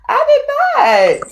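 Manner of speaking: high, loud and slow protest; stretched, indignant no I did not; playful denial. Real, not acted. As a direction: squeal out a mock-offended denial. Denies snapping, teasing co-host.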